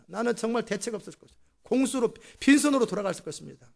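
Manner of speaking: high-pitched, lightly indignant protest; angry complaint. Acted. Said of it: "야곱이 막 화를 내면서". (약간 화난 높은 목소리로 항변하기)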